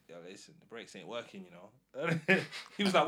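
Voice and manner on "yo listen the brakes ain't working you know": much softer, urgent low warning; hushed confiding. Retold or imitated. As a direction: tell your brother quietly so others won't hear. Secretly telling brother about brakes.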